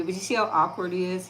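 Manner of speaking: ordinary talk, nothing marked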